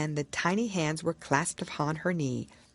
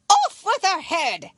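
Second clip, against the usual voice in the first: very high, loud royal shriek; furious shout; 8 dB louder than usual. Acted. Queen ordering Alice's execution, shouting furiously. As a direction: scream the order like a raging queen. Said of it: "the Queen shouted at the top of her voice".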